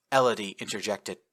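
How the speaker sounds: plain reading voice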